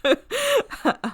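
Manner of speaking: high, loud burst of laughter; amused laugh. Real, not acted. Co-host joking about Quinn's windmill kick, amused laugh.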